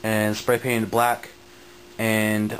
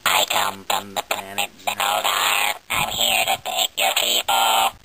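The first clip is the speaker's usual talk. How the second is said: much lower, slow, distorted alien; 3 semitones lower, slower than usual. Pretend. Showing the toy's alien voice setting in a slow distorted voice. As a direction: drag it out like an invading alien. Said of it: "it also has alien voice".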